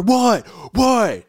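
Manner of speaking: high, loud startled questions; alarmed shouting. Retold or imitated. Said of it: "He's looking everywhere".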